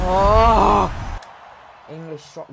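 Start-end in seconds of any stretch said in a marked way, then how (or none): 0.1-0.9 surprised shout. Real, not acted. Reacting to a pack reveal.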